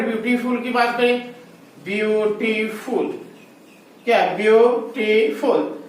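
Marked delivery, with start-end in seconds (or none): 1.8-3.1 beautiful slowly in three separate beats; beautiful, counted out syllable by syllable; split into syllables. Shown as a demonstration. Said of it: "ये one one one three syllable". 4.3-5.8 beautiful very slowly, three distinct beats; beautiful, spelled out beat by beat for students; split into syllables. Shown as a demonstration. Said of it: "ये one one one three syllable".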